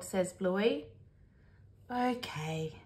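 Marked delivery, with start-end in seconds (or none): 1.9-2.8 soft, downcast little reply; sad. A narrator's reading. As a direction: give in sadly and quietly. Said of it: "says Bingo sadly".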